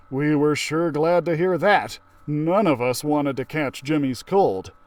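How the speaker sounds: plain reading voice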